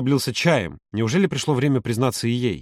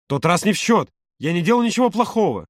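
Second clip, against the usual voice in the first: high, defensive outburst; louder protest; 7 semitones higher than usual. A narrator's reading. Володя defending himself to Юра, loud protest. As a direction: protest innocence with raised voice. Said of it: "тем громче Володя".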